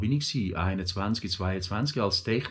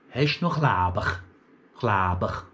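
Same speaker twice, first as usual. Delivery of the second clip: put-on colleague's Thurgau accent; 4 semitones lower than usual. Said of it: "der sagt einmal".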